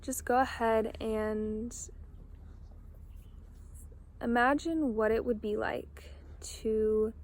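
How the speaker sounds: slow, spacious meditation prompt; unhurried guide voice with long pauses; guided meditation. Real, not acted. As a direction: ease them into imagining, pausing between phrases. Guides listeners to imagine.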